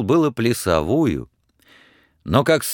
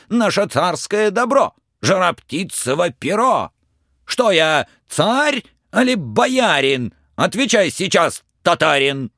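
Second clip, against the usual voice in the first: high, stern, bossy tsar voice; a wrathful old tsar barking orders; angry tsar; 9 semitones higher than usual. Acted. Tsar interrogating Ivan about the firebird feather, angry.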